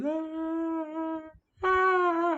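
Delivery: high mock sobbing cries; retold crying gasps; imitating her crying. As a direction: wail like someone in pain on the phone. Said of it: "So she's crying".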